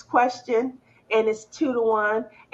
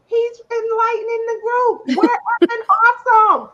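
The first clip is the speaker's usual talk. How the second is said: higher, louder enthusiastic defense; excited mock praise; 9 semitones higher, 6 dB louder than usual. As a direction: gush about the kid's progress.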